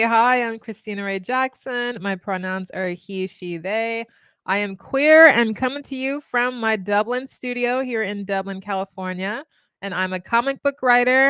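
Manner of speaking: normal talking voice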